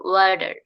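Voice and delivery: ওয়াটার as "wader", T like D; American flap T. Shown as a demonstration. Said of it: "যখন এটা আমেরিকানরা বলে".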